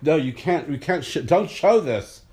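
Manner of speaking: mock the organizers panicking; hammed-up alarmed order; panicked monitors. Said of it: "They were like".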